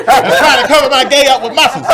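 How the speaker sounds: laughing. Real, not acted. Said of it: "laughing through the story".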